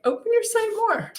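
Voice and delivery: very high sing-song nudge; coaxing. Retold or imitated. Spiritual team urging her to look further, high mock coaxing.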